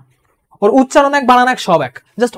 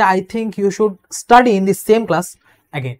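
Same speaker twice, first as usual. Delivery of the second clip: gentle polite phrasing; much slower than usual. Shown as a demonstration. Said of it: "একটু সুন্দরভাবে".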